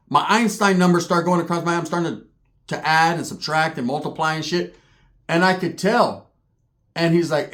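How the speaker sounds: ordinary delivery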